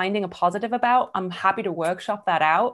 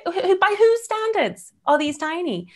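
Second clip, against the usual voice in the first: much higher, louder, slow indignant challenge; 10 semitones higher, 4 dB louder than usual. Retold or imitated. Defending herself to the audience, indignant and much higher. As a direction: challenge it, outraged. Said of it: "I had to be like".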